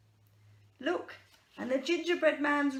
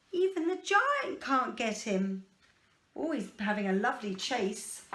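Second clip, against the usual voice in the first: ordinary delivery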